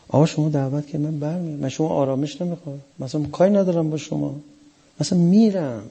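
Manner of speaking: high, hushed and quick, explaining Hussein's offer. Retold or imitated. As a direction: raise the voice and soften it, as if pleading gently. Paraphrasing Hussein's offer, high and soft.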